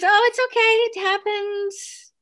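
very high, sing-out receptionist voice; breezy receptionist reassurance. Retold or imitated. Front desk girl excusing the elevator call, high breezy voice. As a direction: imitate her high, drawn-out reassurance.

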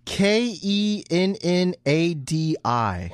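spelling slowly. Shown as a demonstration. Spelling out a name.

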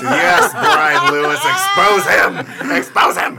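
loud, high cheering on; egging a friend on at full voice; gleeful shouting. Real, not acted. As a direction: yell it like a rowdy heckler.

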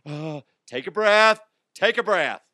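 hammed-up shout telling her to breathe; mock calming yell. Husband yelling at crying wife to take a breath, mock loud. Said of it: "I'm like".

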